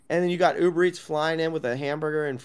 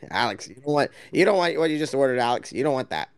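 imitating Machi; 6 semitones lower, faster than usual. Friend imitating Machi ordering for him.

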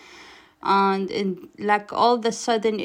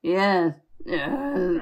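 trying not to laugh. Real, not acted. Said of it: "I'm trying to be serious".

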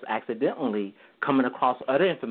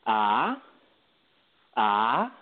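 "ah" rising like a question; rising Mandarin tone on "ah"; second tone; 2 semitones lower than usual. Shown as a demonstration. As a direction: lift "ah" upward as if asking. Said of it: "raising your voice in a form of a question".

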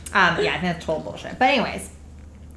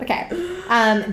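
ordinary talk

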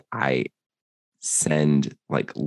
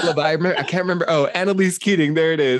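laughing; 12 semitones higher, faster than usual. Real, not acted. Laughing, acting out leaving.

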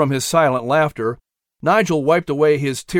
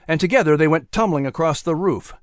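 plain reading voice

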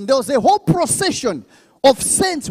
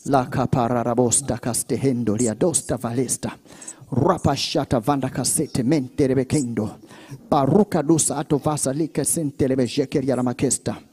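very low, subdued murmured prayer; hushed tongues prayer; 10 semitones lower, 4 dB quieter than usual. Real, not acted. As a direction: drop deep and soft, slipping into tongues.

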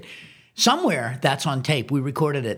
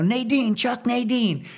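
urgent calling; 9 semitones higher, slower than usual. Retold or imitated. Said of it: "you know".

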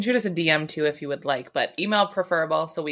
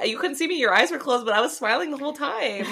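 higher, bubbly excited talk; 8 semitones higher than usual. Real, not acted. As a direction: gush about how happy you were. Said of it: "so excited".

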